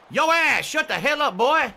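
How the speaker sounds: loud, high Southern outburst; terrible southern accent. Acted. Streamer in costume telling off a chatter, loud hammed-up Southern accent. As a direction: holler it like a bad Southern colonel. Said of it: "this terrible southern accent".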